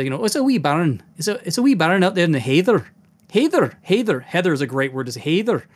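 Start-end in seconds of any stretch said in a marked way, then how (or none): 0.2-4.2 lilting Scottish burr with swooping pitch; broad, lively Scottish accent. Shown as a demonstration. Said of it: "if it's Scottish, I always have a". 5.2-5.6 HEATHER in a Scottish accent, as HAY-ther; higher Scottish take on HEATHER. Shown as a demonstration. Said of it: "if it's Scottish".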